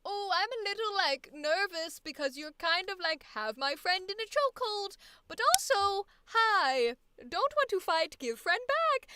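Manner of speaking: high, nervous and awkward; nervously polite, don't want to fight. Retold or imitated. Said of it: "perfect balance of".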